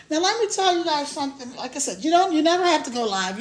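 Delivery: usual speaking voice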